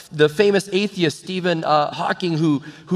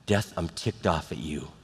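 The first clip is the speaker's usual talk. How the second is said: quiet anger; 9 semitones lower, 7 dB quieter than usual. Retold or imitated. Jesus confronting death at Lazarus's grave, quietly angry. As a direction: address the enemy softly, dragging out the hate. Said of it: "he's angry with it".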